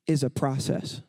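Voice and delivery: normal talking voice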